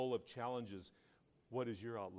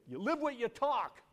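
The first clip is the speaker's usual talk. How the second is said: high, loud burst of admiration; emphatic retold praise; 13 semitones higher, 9 dB louder than usual. Man admitting he admires the preacher, emphatic loud praise.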